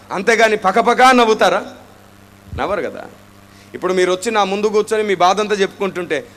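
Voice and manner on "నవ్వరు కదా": ordinary talk, nothing marked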